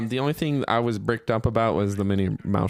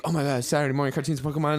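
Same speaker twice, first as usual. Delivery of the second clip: fast, higher, louder gush; excited; 4 semitones higher, 5 dB louder, faster than usual. Retold or imitated. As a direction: blurt it out like a thrilled kid. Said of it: "I was like".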